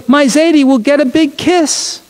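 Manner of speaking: put-on high, gleeful child's voice; joyful little girl. Sarah jumping with joy about her grandpa, gleeful. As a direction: squeal it with joy like a small girl. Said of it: "Sarah jumped up and down with joy".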